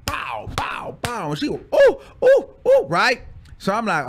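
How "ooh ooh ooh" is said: very high, loud mock moans; mock moaning oohs. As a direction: ham up the ecstatic oohs. Mimicking moaning in retold scene.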